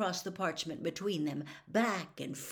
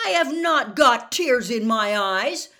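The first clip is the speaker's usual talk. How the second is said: much louder, indignant protest; loudly; 5 semitones higher, 14 dB louder than usual. Acted. Harry loudly denies the reporter's invented tears. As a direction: shout him down, offended. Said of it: "said Harry loudly".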